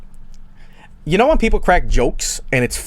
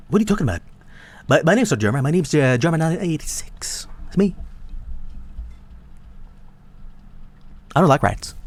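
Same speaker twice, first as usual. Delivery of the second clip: lightly imitated streamer voice, trailing softer; Jerma impression; 3 semitones higher, 4 dB quieter, faster than usual. Streamer insisting to Jerma his name is not Jerma, mock innocent impression. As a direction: act innocent in a put-on voice. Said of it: "you guys think that impersonation sounds good?".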